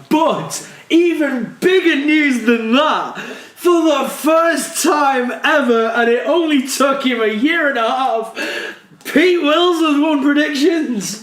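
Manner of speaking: big drawn-out shouted announcement, high-pitched; triumphant announcement. Real, not acted. Host reveals the first-time predictions winner, triumphant announcement. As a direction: build it up like a ring announcer.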